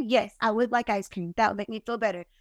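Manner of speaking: small sad self. Pretend. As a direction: answer like a pouting child being comforted. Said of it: "give myself a hug and say".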